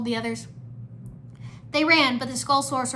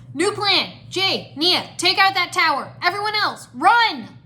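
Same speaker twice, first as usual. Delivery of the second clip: high, loud, punchy commands; shouted orders; 8 semitones higher, 9 dB louder, slower than usual. A narrator's reading. Lloyd directs the ninja under attack, urgent shout. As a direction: bellow orders to the team. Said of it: "Lloyd shouted".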